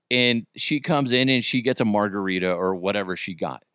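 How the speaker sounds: ordinary talk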